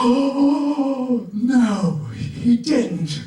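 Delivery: high, loud gasping outrage; hammed-up shocked gasp; mock shock. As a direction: squeal the disbelief like a scandalized friend. Imitating friend's shocked reaction.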